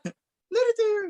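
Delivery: high, slow squealed cheer; excited cheerleader. Retold or imitated. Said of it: "he's so excited".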